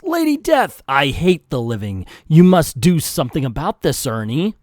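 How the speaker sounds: loud acted villain outburst; loud, hammed-up cry; Ernie's yell. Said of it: "And Ernie yells".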